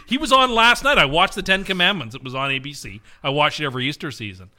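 normal talking voice

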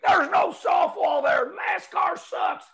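high, loud fan yelling from the stands; angry fan shout. Retold or imitated. Fans in the grandstand yelling at NASCAR, high loud shout. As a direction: shout it like a furious fan on his feet. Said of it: "Crowd on feet".

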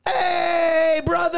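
high, drawn-out mock shout; teasing group greeting. Gym mates razzing the guy who hid his fling, mock cheer. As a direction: call it out like a rowdy welcome.

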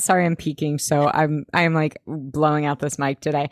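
ordinary delivery

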